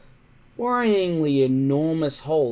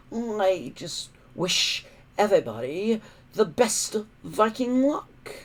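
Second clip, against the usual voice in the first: higher, oily fake-friendly voice; smooth smiling villain; 9 semitones higher than usual. Alvin wishing the departing hooligans luck, smooth and smiling. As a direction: sweet-talk them while you plot. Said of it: "smiling smoothly and easily".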